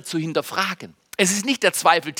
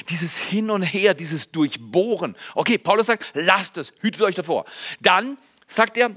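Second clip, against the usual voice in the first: ordinary delivery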